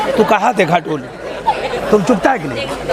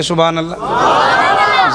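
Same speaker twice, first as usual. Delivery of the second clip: leading the call; 5 semitones lower, much slower than usual. Shown as a demonstration. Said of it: "कहिए".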